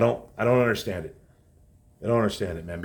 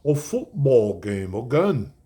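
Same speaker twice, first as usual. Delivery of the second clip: mimicking Rob Parker. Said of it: "That's the way he talks".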